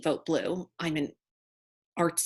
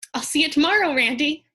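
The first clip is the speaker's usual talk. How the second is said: high, louder cheerful farewell; smiling, hopeful; 8 semitones higher, 6 dB louder than usual. Retold or imitated. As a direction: call goodbye through a big hopeful smile. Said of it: "with a big smile and a glimmer of hope".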